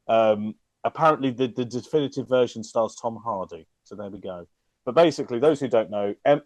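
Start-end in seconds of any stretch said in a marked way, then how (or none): none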